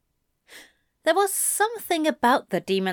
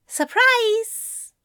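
high-pitched, sing-out greeting; sheepish surprise; 12 semitones higher, 4 dB louder than usual. A narrator's reading. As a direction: announce yourself with a bright, high lift. Angel greets stunned demon sheepishly.

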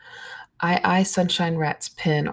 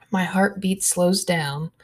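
ordinary delivery, nothing marked

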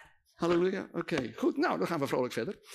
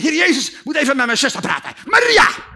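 loud, rising to a high yell of Maria; furious Martha; 7 semitones higher, 10 dB louder than usual. Acted. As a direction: storm in and bellow for her sister.

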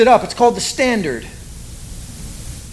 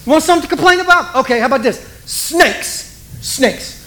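mock-angry God; 7 semitones higher than usual. God answering complaining Israel, high fast mock taunt. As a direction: fire it off high, then land on snakes. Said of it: "that's what he does".

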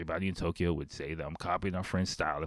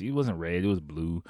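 ordinary talk

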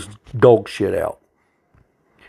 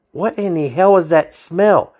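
higher, slow what in the hell; appalled demand; 6 semitones higher, slower than usual. Retold or imitated. Trooper demanding to know where the stench comes from, slow and appalled. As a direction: drag out the outrage.

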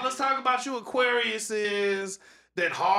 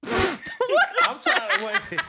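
laughing; 12 semitones higher, 4 dB louder, much slower than usual. Real, not acted. Laughing, struggling to follow story.

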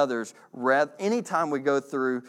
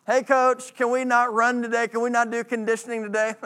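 higher, pleading teammate voice; whiny teammate; 6 semitones higher than usual. Retold or imitated. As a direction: beg the coach to skip running. Teammate whining to coach.

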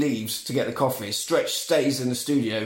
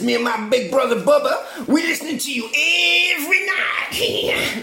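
high, crazed shout stretching the last words; manic Chop Top; 12 semitones higher than usual. Retold or imitated. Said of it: "That's literally what he's like".

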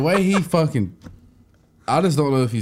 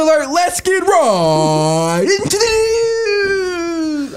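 very slow, much higher, loud screaming intro; Keemstar impression; 5 dB louder, much slower than usual. Friend imitating Keemstar's intro, screaming slow and high. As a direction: scream the show opener. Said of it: "I cannot believe I just did that".

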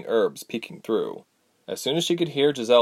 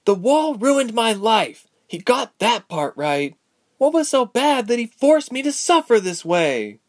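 high, loud, slow anguish; anguished outburst; 12 semitones higher, 5 dB louder than usual. Acted. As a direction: cry out against the injustice. Ilse outburst about the wall.

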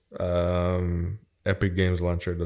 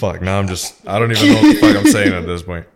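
laughing through the words; 12 dB louder than usual. Real, not acted. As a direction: crack up at your own flub. Flubs outro, laughs at himself.

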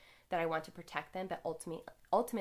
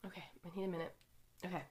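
composing herself; 6 dB quieter, much faster than usual. Real, not acted. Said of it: "I'm getting emotional".

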